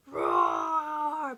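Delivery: high, loud dragon roar; bellowed. Acted. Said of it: "bellowed the dragon".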